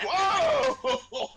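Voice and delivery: very high, loud laughing whoa; shocked laughing whoa. Retold or imitated. Family hearing grandma swear, very high laughing whoa. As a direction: whoop in shock and delight. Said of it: "We're like".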